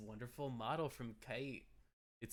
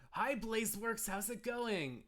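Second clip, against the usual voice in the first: high, louder friendly call; excited greeting; 11 semitones higher, 5 dB louder than usual. Real, not acted. Streamer breaking off to hail Blazeworks, excited greeting. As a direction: greet brightly in a raised pitch.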